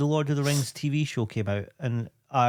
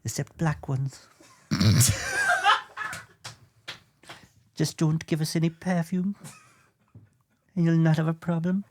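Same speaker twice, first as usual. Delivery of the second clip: put-on northern queen, pitched up, accent slipping; 5 semitones higher than usual. Singer as queen laying down terms, high comic impression. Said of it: "[name] as the queen".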